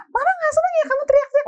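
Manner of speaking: high, fast, loud mock scolding; yelling mother. Shown as a demonstration. Mama yelling at her child not to yell, hammed-up shouting. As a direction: screech it at the child in a rush. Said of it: "Lah, mamanya teriak".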